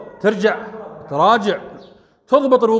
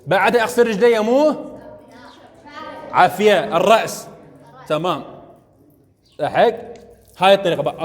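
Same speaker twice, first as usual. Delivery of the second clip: ordinary talk, nothing marked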